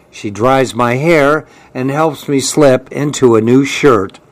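usual speaking voice

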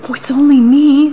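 high, nervous little goat; timid little goat. Acted. Little goat answers the troll, high and timid. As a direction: squeak it out, small and scared.

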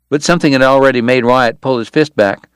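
ordinary delivery

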